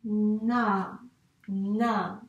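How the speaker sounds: "na" slowly drawn out; blended syllable. Shown as a demonstration. Teacher reads back the finished syllable, sounded out. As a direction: hold both n and a in "na". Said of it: "Moi je veux na".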